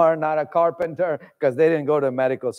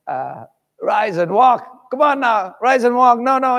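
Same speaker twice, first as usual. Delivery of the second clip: loud, fervent pulpit preaching; preacher hammering his point to the congregation; preaching at full force; 4 semitones higher, 6 dB louder than usual. Acted. Preacher driving home healing versus forgiveness, loud and fervent.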